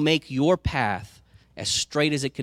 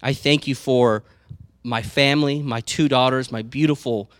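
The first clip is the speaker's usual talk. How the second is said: louder, earnest prayer voice; 5 dB louder than usual. Real, not acted. Pastor thanking God for his family, praying aloud. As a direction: pray it out with thanks.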